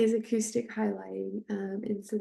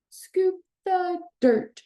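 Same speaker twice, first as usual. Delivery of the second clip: scoop stressed, higher and slow; acoustic highlighting; 9 semitones higher, 4 dB louder, slower than usual. Shown as a demonstration. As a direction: sing it out high and slow for a child. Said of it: "provide that first auditory sandwich".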